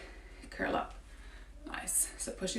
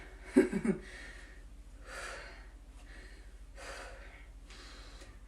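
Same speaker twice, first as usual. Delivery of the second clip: soft breathy laugh; 6 dB quieter than usual. Real, not acted. Laughs at own croaky voice.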